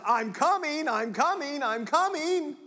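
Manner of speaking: a hint of a barking dog; dog's warning. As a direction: play the dog warning you off. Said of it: "They warn us".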